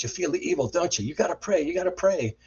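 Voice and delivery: high, insistent voice; retold urging. Man at the table urges his guest to pray, high insistence. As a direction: imitate someone pressing an anxious plea.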